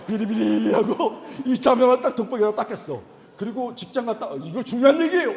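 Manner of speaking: loud laughing telling. Real, not acted. Pastor tells the congregation, loud and laughing. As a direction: push it out loud through laughter.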